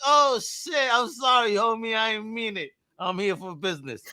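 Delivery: hammed-up sheepish backpedal; mock flustered apology. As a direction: plead high, playing up the embarrassment. Retells own flustered apology.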